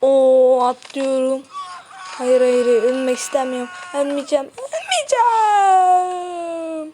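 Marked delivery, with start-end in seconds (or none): 3.9-6.9 ölmeyeceğim stretched very slow and higher, crying; drawn-out wail. Real, not acted. Gamer refusing to let his character die, slow crying wail.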